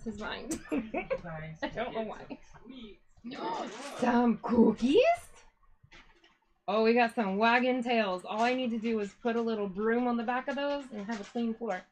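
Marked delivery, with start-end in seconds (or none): 3.2-3.9 high surprised gasp; a quick delighted intake of breath; sharp gasp. Real, not acted. Dogs wagging, cookies. 4.0-8.2 loud cheerful call to the dogs; excited pet voice; louder, excited dog talk. Real, not acted.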